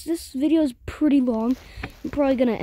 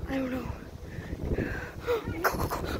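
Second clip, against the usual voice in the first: whispering; 10 dB quieter than usual. Real, not acted. Urging friends to hide.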